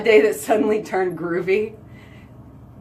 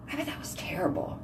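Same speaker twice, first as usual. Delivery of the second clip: much softer, fast, breathy whisper; 3 semitones lower, 16 dB quieter, faster than usual. Real, not acted. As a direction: whisper it in sympathy. Repeat whispered.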